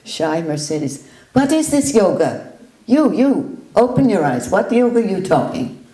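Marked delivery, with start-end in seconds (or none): 1.3-5.8 loud, higher, demanding; forceful challenge. Retold or imitated. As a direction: confront her bluntly and loud. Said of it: "He would say".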